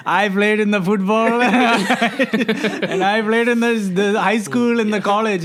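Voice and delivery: put-on drawn-out, playful old-timer brag; boastful dad. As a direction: mimic an uncle boasting of his playing days. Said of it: "the dad that's gonna be like".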